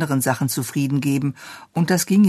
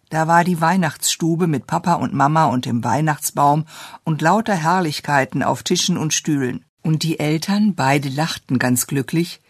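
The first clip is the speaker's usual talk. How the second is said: ordinary delivery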